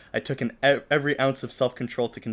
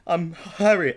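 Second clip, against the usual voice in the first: higher, louder flustered introduction; nervous stammer; 7 semitones higher, 6 dB louder, much slower than usual. A narrator's reading. Introducing himself to pop idol, mock nervous stammer. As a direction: blurt the name like a starstruck fan. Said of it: "I stammered".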